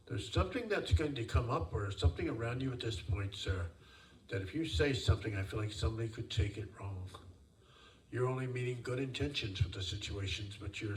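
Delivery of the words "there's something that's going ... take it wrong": ordinary talk, nothing marked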